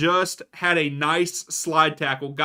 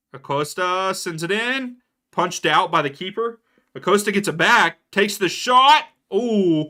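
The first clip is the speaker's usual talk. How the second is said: rising excitement, louder and higher to the shot; building play-by-play; 5 semitones higher, 6 dB louder than usual. Real, not acted. Streamer calling a late Cincinnati attack, building excitement. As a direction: build the call as the attack closes in.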